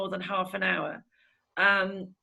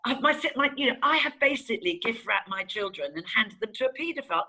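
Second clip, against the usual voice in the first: usual speaking voice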